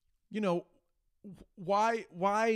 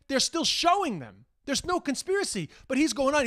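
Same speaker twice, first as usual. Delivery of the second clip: ordinary talk, nothing marked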